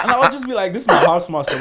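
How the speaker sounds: high and loud through laughter; laughing. Real, not acted. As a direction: laugh while telling it. Laughing retelling of the housemaster.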